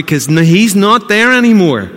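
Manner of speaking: exultant proclamation. Acted. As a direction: ring it out. Preacher exults about the risen Christ.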